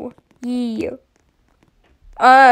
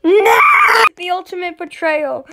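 high cry of no, then a mock-tragic line; mock wail of betrayal; 5 semitones higher, 3 dB louder than usual. Real, not acted. Player wailing after the giant drops him in lava. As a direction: overplay the betrayal.

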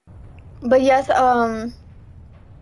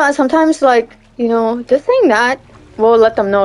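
normal talking voice

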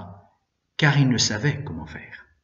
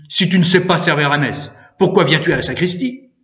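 loud, higher-pitched scolding question; narrated fury with raised voice; furious sacristan; 4 semitones higher, 6 dB louder than usual. A narrator's reading. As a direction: berate the boy angrily. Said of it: "continua le sacristain furieux".

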